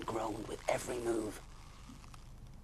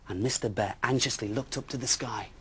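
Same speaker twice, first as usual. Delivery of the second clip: ordinary delivery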